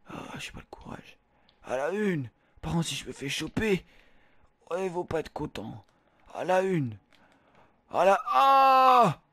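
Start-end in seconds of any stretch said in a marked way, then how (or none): 0.1-1.2 whispering. Acted. Scared at the diving board. 7.9-9.2 startled shout. Acted. Falls off the diving board.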